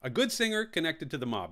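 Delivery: ordinary talk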